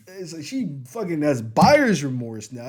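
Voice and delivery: ordinary talk, nothing marked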